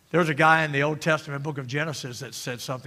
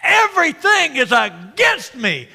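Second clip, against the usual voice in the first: high, loud acted wail; frustrated outburst; 8 semitones higher, 10 dB louder than usual. Frustrated Jacob laments to his sons, high loud outburst. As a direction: cry it out in exasperation. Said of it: "he's frustrated".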